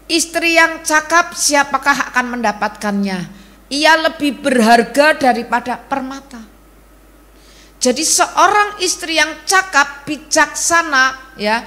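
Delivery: fervent sermon delivery; full-force preaching. Acted. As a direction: proclaim it to the congregation.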